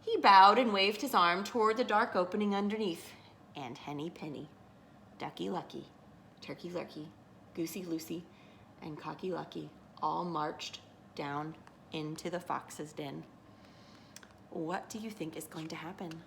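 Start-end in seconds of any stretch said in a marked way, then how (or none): 3.5-13.2 soft, low narration with pauses; hushed suspense. A narrator's reading. Narrator as birds march into the fox's den, hushed. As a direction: drop down and name each one ominously.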